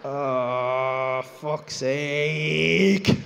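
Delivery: long low groan of realisation; drawn-out exasperated moan; dismayed groan. Retold or imitated. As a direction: let it drag out and swell. Realises it is his wife.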